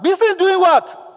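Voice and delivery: high, loud, drawn-out exasperated shout; shouted question. Retold or imitated. Man demanding answers from a child, shouting. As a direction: imitate a caller yelling down the phone. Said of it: "Then the man shouted".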